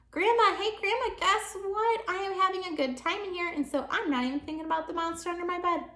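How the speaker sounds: put-on high, gleeful kid calling out; happy little girl calling grandma from her room; excited calling child. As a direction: call out to grandma full of glee.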